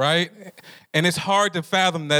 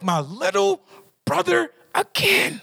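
put-on much higher, slow fed-up older brother; exasperated Esau; 13 semitones higher, slower than usual. Esau finding his younger brother tricked him again, high exasperated groan. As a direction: groan it like a betrayed big brother.

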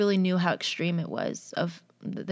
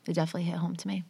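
repeated under her breath, softer; whispered echo; 6 dB quieter, much faster than usual. Real, not acted. Repeating it quietly to herself, whispered. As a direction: let it fade to a whisper. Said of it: "I'm getting emotional".

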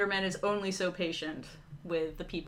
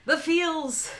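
dramatic cry; 10 semitones higher, 6 dB louder than usual. Real, not acted. Dramatic exclamation.

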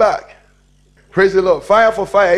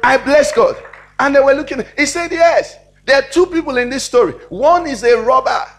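raised, louder preaching voice; full-force preaching; 5 semitones higher, 4 dB louder than usual. Acted. Preacher telling the robbed man's reply to the church, emphatic preaching. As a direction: preach the story out high and emphatic.

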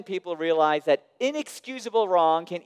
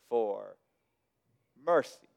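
ordinary delivery

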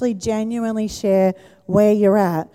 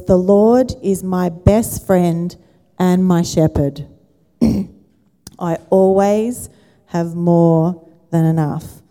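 scripture reading; 3 dB louder, slower than usual. Acted. Reading Passion Translation slowly.

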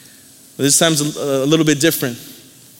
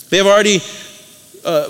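ordinary talk

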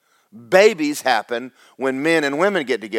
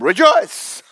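joyful angel; 10 semitones higher, 6 dB louder than usual. Retold or imitated. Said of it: "the angel said".

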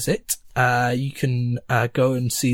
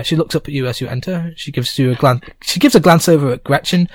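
normal talking voice

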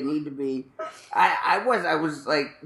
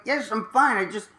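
whiny denial; 6 semitones higher, 3 dB louder than usual. Retold or imitated. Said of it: "I'm like".

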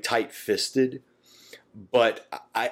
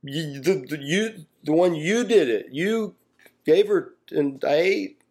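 put-on much higher stammering childish whine; little kid excuse; 7 semitones higher than usual. Adam blaming God like a little kid, high whine. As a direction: stammer out blame like a caught kid. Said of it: "This is such a little kid moment".

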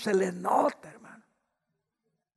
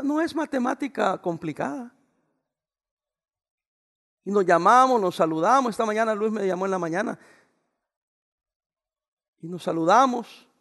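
ordinary talk

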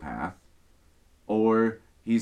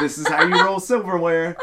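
higher, louder put-on cheer, quick; happy server voice; 7 semitones higher, 7 dB louder, faster than usual. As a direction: imitate a chipper server explaining. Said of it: "puts on the happy server face".

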